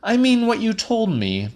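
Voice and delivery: high, fast insistent Celia; Celia's voice. A narrator's reading. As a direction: push it high and quick. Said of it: "she said".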